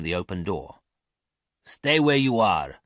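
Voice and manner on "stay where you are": menacing man. A narrator's reading. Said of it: "said a man's voice behind him".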